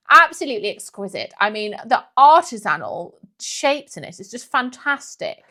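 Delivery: loud mock-posh gushing; imitating a pseudo-posh dinner guest; pseudo-posh accent. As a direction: overblown praise in affected posh English. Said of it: "Because she talks like that".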